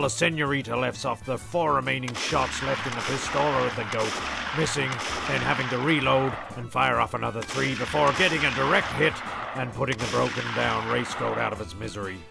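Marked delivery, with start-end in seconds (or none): none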